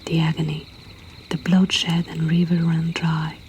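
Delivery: usual speaking voice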